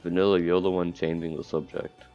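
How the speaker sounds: ordinary delivery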